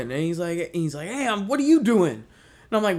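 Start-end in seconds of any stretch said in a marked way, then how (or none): 1.1-2.1 high, loud retort as the intruder; acted-out defensive shout; yelling back. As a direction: throw the challenge right back, loudly. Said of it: "this yelling match".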